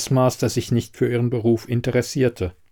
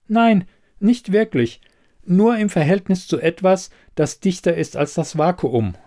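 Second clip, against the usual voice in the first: higher-pitched expert voice, measured opening; explaining investigator; 8 semitones higher than usual. Acted. Elida explaining physics to the puzzled quizmaster, higher expert voice.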